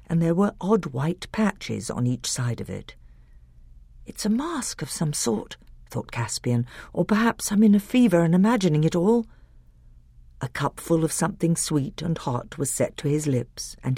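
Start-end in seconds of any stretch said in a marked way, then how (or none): 4.1-9.3 Caspian's dazed thoughts. Acted. Caspian thinks feverishly.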